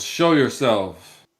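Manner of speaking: slow, loud commanding shout; yelling. Acted. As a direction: bark the order down from horseback. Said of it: "He yells".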